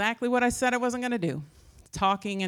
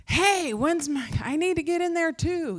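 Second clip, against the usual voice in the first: mock demanding yell, pitched way up; high, pushy imitation of an impatient housemate; super intense housemate; 9 semitones higher, 4 dB louder than usual. Intense housemate demands a turn in the bathroom, mock yelling. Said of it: "they were super intense".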